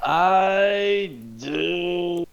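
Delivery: sucking teeth before speaking. Pretend. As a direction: tsk through the teeth, then speak. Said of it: "suck my teeth and be like".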